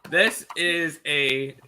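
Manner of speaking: heavily drawn-out mock praise; sarcastic. Real, not acted. Tipster talking up a lopsided matchup, sarcastic. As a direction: stretch it out slowly, leaning hard on "great". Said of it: "I'm obviously being sarcastic".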